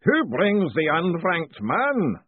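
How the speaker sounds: slow, booming ritual master. A narrator's reading. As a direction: boom it out like a grand master of ceremonies. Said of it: "a voice boomed".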